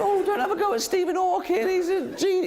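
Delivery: high, prissy mock voice of Hawking fans. Mocking people who defend Stephen Hawking, high silly voice. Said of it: "but people".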